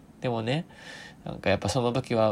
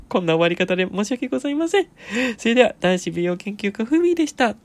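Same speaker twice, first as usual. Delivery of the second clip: laughing; 9 semitones higher, 6 dB louder, faster than usual. Real, not acted. Laughing through the sign-off.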